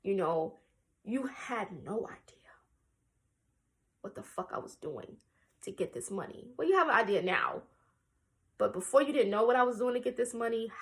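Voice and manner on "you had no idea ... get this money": hushed whisper with a bitter edge. Real, not acted. Telling her father what he never knew, whispered. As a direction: whisper it like a secret grievance.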